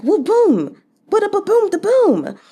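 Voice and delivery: playful booming noises; imitating a pounding shock. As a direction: sound out a heart pounding in shock. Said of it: "We've all been like".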